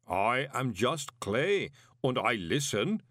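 very slow, serene golem; unshakeably placid clay giant; lightly voiced calm golem; infuriating calm. A narrator's reading. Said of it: "said Pump with the same infuriating calm".

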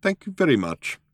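high, coldly polite thanks. A narrator's reading. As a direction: thank them with cold courtesy. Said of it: "said the old man coldly".

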